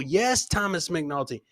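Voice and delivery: ordinary talk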